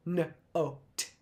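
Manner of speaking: "note" in slow pieces, nuh, oh, t; broken-up "note", each sound alone; unblended word. Shown as a demonstration. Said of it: "But we don't go".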